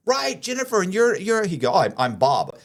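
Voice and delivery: high, gushing fake-friend voice; overly friendly con man. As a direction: gush like you know him well. Con man acting friendly stranger.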